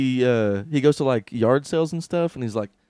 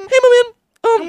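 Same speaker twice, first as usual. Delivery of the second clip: much higher, louder hype-man voice; Gary Vee impression; 10 dB louder than usual. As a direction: pump it up, pitched way up. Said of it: "he's like".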